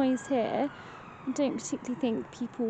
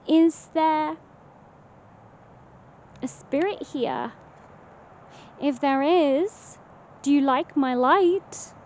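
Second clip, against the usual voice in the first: calling out; 3 semitones higher, 3 dB louder, slower than usual. Real, not acted. Calling out to a spirit.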